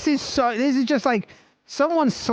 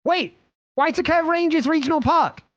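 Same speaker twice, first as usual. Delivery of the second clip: excited realisation; 8 semitones higher than usual. Real, not acted. Sudden realisation while searching.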